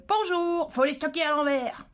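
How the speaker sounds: sung-out high "Bonjour" then the tip, voice put on; greeting first. Shown as a demonstration. Showing viewers the polite version, bright greeting then advice. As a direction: show how the tip sounds with a hello first. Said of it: "Donc, euh".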